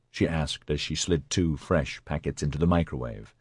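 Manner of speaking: ordinary delivery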